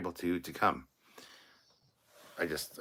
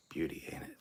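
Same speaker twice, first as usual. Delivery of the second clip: soft whispered pride; whispered pride in his car; admiring whisper; 6 dB quieter than usual. Real, not acted. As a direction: breathe it out in awe.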